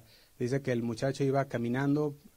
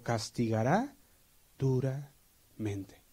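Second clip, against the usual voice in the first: slow emphasis; much slower than usual. Acted. Preacher stressing punishment.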